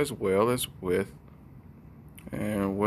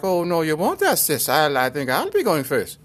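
high, loud mock rebuttal; put-on high squabbling voice; Abby; 6 semitones higher, 5 dB louder than usual. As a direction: argue back in a hammed-up high voice. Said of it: "And Abby was like".

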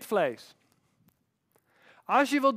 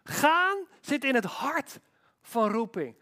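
ordinary talk

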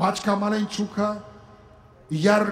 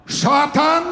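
pitched up in disbelief, a little louder; shocked question; 6 semitones higher, 3 dB louder than usual. Retold or imitated. Priest asking his ex who took the photo, in disbelief. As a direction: ask it like you can't believe it.

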